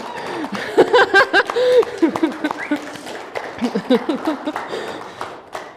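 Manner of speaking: laughs high and long into the mic; light, delighted laughter. Real, not acted. Congregation applause, pastor laughs.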